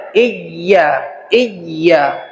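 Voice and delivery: "Iyya" with the doubled y held and pressed hard; pressed tasydid. Shown as a demonstration. Teacher demonstrating the pressed tasydid to students, firm and deliberate. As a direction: lean on the y in "Iyya", doubling it clearly. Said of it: "tekan, ya".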